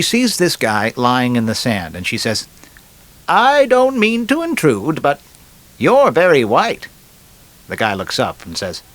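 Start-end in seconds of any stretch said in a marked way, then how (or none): 3.3-6.9 very high, slow, drawn-out lady voice; lady in a joke. Acted. Lady on a Miami beach to a stranger, high mock lady voice.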